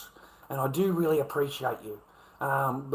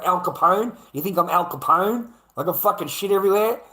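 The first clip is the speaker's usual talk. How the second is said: higher and louder, mocking disbelief; incredulous scoff; 5 semitones higher, 5 dB louder than usual. Real, not acted. Mocking police who think he's a gangster, higher and louder. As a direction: make the idea sound ridiculous.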